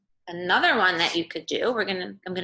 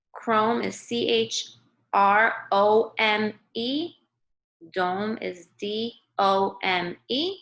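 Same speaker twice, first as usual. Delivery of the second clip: slow spelling out; much slower than usual. Shown as a demonstration. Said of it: "teacher spells words letter by letter".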